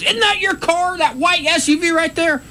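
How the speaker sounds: very high, accusing mock yell; retold yelling. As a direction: imitate an officer yelling an accusation. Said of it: "just yelling at me".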